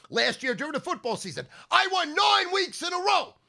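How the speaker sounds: loud, high-pitched ranting pitch; fired-up rant. Real, not acted. Podcaster preaching about gambling to listeners, loud fired-up rant.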